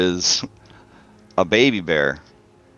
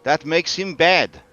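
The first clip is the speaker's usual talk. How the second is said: high, deliberate character voice; Kavani woman's voice; 7 semitones higher, 4 dB louder than usual. Acted. Game master as the woman warning about the dragon, solemn. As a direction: speak high as the solemn stranger.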